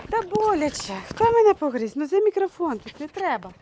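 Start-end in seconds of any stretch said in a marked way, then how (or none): 0.1-1.9 high, loud yelp of pain; startled, loud and higher; ouch. Real, not acted. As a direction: cry out after a bite. Bitten by the puppy.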